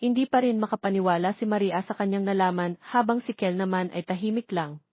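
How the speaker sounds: usual speaking voice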